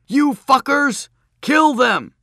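high, slow furious yell; angry mob shout. A narrator's reading. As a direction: scream at them like a mob. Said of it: "mob with pitchforks".

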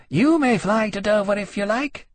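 high-pitched, dismissive she-dragon. A narrator's reading. She-dragon answers her rival, dismissive. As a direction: wave him away in a high voice. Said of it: "flicking the tip of her tail dismissively".

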